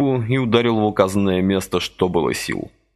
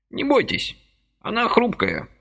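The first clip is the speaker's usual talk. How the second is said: put-on high, chirpy old man's voice; lively old man pressing a deal; brisk old man. A narrator's reading. Lively old man persuading Vasily, high chirpy voice.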